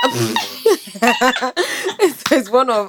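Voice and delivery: high, laughing stumble over words. Real, not acted. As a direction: break up laughing mid-sentence. Co-host squeals, speaker breaks into laughter.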